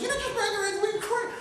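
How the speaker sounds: high, far-off mock scream; retold screaming. Recruit screaming at his teammate with the broken ankle, high yell. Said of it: "Screaming at him".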